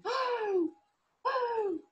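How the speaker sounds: high, shocked little gasp of "oh". Acted. Boy seeing his goat under the fallen tree, shocked. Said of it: "so shocked that he's going".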